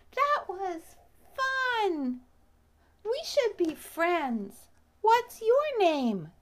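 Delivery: high, slow friendly bee voice; a happy bee making a friend; cheerful little bee. Acted. Bee character speaking.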